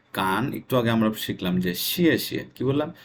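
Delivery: normal talking voice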